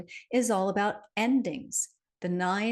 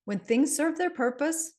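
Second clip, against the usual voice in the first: lightly voiced sage, pitched higher; Yoda quote; 8 semitones higher than usual. Host quotes Yoda to listeners, lightly pitched up. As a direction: quote the little master in a raised voice. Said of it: "But Yoda would say that".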